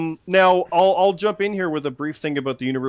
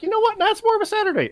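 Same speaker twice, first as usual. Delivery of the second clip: high-pitched mock voice; a squeaky imitated decision maker; faster than usual. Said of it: "they can be like".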